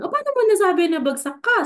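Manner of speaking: high, quick mother questioning; mother's voice. Retold or imitated. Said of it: "sabi niya sa akin". (boses ng nanay, mataas at mabilis)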